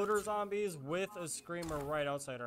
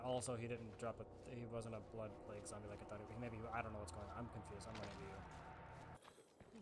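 hushed; 10 semitones lower, 16 dB quieter than usual. Real, not acted. Sneaking past zombies.

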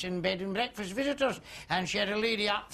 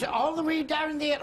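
higher, louder astonished question; mock surprise; 9 semitones higher, 5 dB louder than usual. London guest shocked by the outdoor toilet, mock surprise. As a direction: gasp it out, mildly scandalised.

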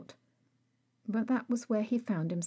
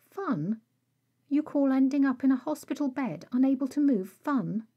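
higher and louder, indignant; outraged disbelief; 7 semitones higher, 6 dB louder than usual. Acted. Bones scolding Rag over the hospital bed, indignant. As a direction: throw his word back at him, appalled.

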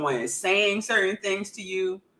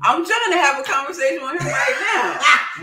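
loud, high laughing outburst; laughing loudly while teasing back; laughing shout; 8 semitones higher, 7 dB louder than usual. Real, not acted.

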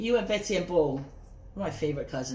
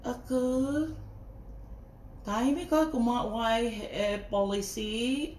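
slow, halting mock of a hesitant speaker; mocking impression of the woman on live; imitating her; 3 semitones higher, 3 dB quieter, much slower than usual. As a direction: mimic her groping for words. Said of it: "this is how she said".

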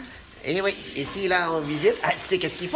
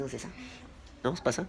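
usual speaking voice

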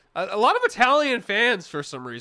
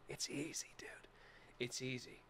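breathy whispered threat, much softer; menacing whisper; 8 semitones lower, 23 dB quieter than usual. Acted. Tiny boxer threatens an opponent's shins, whispering. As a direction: hiss it like a tiny assassin.

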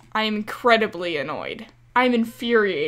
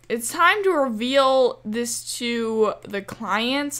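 ordinary talk

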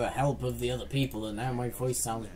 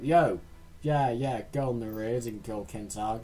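normal talking voice

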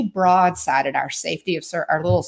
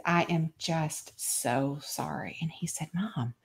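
soft, very slow and contrite; nearly whispered sorrow; hushed apology; 13 dB quieter, slower than usual. Retold or imitated. Mother apologizing to her grown son, hushed.

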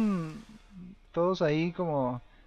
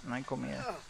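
flat resigned statement; 4 semitones lower, 14 dB quieter than usual. Shown as a demonstration. Gamer confirming there is no food, low resigned murmur. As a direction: lower and much softer.

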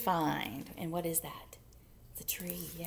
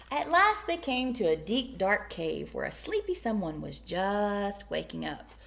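ordinary delivery

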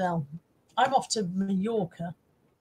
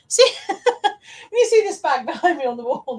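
laughing; 6 semitones higher, 8 dB louder than usual. Real, not acted. Laughing at her own bag joke.

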